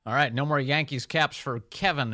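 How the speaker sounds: ordinary talk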